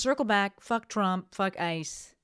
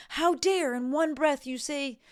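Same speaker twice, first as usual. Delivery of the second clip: mock an outraged commenter catching a contradiction; a lightly voiced huffy YouTube critic; indignant critic; 6 semitones higher than usual. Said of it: "someone can watch it and be like".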